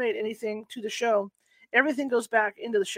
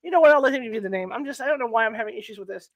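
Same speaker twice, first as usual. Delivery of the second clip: ordinary delivery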